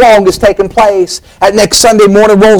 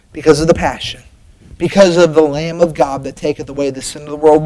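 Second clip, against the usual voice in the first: much softer and lower, reverent; hushed answer; 4 semitones lower, 7 dB quieter than usual. Acted. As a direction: drop down and let it land. Preacher drops to answer.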